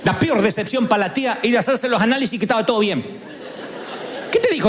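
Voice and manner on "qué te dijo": mock question.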